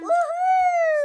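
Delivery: high squealing woohoo; excited cheer. Real, not acted. Child cheering that story time starts.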